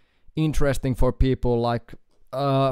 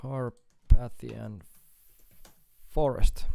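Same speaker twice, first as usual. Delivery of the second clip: slow spelled-out name; much slower than usual. Real, not acted. Repeats band name slowly.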